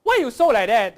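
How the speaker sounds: very loud, high Singlish reproach; indignant zigzag Singlish accent. Shown as a demonstration. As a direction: exclaim it with a Singaporean swing. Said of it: "and Singlish".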